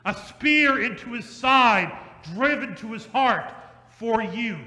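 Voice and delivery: rhythmic sermon cadence, pausing before each for you; preaching at full force. Acted. Preaching the passion refrain.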